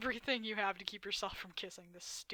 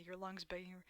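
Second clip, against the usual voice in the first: ordinary delivery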